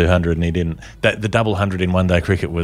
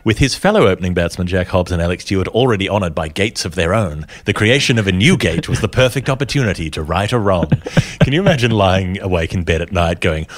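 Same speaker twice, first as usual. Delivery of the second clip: ordinary delivery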